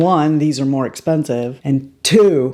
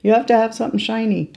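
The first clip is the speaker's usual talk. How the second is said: high, insistent inner nag; high-pitched demand from the fixated self; obsessive inner voice; 6 semitones higher than usual. Acted. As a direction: act out your obsessive side, pitched high. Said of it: "this obsessive person in me goes".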